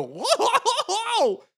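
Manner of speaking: high, loud Goofy whoa with a laugh. Retold or imitated. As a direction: squeal it like a clumsy cartoon dog. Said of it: "you hear Goofy go".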